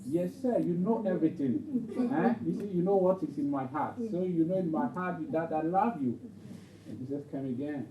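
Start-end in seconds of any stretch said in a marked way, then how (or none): 0.1-6.2 higher, louder, earnest protest; insistent Peter. Acted. Peter answering Jesus's repeated question, insistent and raised. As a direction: protest your love, voice raised. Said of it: "And Peter will be upset".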